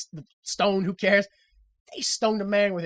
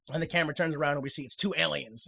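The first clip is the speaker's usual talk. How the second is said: usual speaking voice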